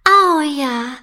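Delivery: a bright, eager yes; light storybook enthusiasm; delighted. A narrator's reading. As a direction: princess thrilled by the midnight feast idea, eager. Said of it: "ist von der Idee begeistert".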